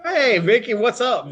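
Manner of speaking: high, loud shout-out to a friend; calling out hello. Real, not acted. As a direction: send a big hello across to someone. Sends Vicky a hello at the hall.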